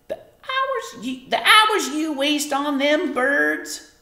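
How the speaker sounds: high, slow scolding mother voice; Homily scolding. A narrator's reading. Mother nagging daughter about watching birds, high scolding voice.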